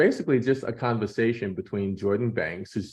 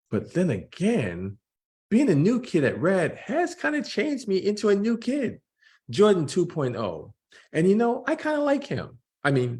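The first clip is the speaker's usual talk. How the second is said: put-on higher, boyish voice for Jordan; young Jordan voice; 6 semitones higher than usual. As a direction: play a thirteen-year-old weighing hopes. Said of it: "Here's Jordan's cartoon".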